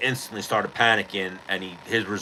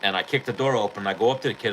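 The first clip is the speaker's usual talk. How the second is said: ordinary talk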